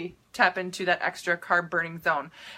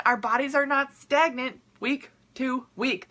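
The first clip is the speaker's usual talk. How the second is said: normal talking voice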